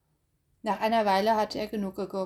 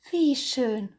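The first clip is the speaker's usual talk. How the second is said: usual speaking voice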